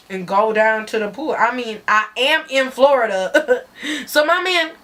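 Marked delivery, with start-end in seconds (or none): none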